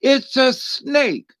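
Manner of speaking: high, slow insistence: it's a snake; drawn-out exasperated reply, pitched high; deadpan retort. Acted. Flat retort to naive optimist.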